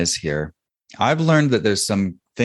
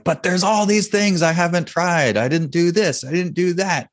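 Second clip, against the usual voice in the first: mock pleading protest; 11 semitones higher than usual. Store owner protesting to his mastermind, mock pleading. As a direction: imitate yourself arguing back in a much higher voice. Acting out own past protest.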